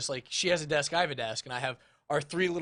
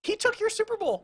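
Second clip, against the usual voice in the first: much higher, teasing; mocking. Real, not acted. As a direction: tease the rival, high. Mocking, very high.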